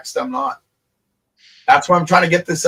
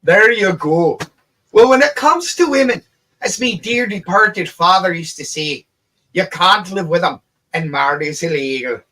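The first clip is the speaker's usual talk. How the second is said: light imitation of a father's Irish accent, raised pitch; a hint of an Irish brogue, joking; 4 semitones higher, 4 dB louder than usual. Son doing his late dad's Irish joke, light Irish accent. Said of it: "my dad used to do this Irish voice".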